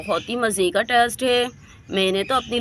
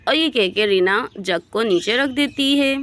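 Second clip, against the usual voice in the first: ordinary delivery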